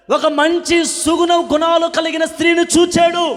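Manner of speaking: loud, high, ringing preacher's cry; fervent revival preaching, loud and lifted; full-force preaching. Acted. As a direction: preach it out to the whole hall. Preaching build.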